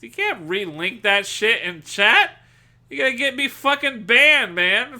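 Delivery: exasperated shout at a chatter; real loud scolding. Real, not acted. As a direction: scold him loudly, half joking. Scolding chatter over relink.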